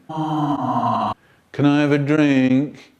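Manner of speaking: moaning.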